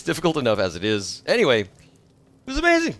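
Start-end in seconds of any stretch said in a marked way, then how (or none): 1.6-2.9 squeaky high burst of excitement; thrilled. Real, not acted. Streamer recalling the Hitchens debate, squealing excitedly. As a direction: gush it out.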